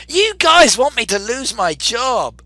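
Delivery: high, loud, slow exclamation; mock protest. Real, not acted. Mock protest at poll result.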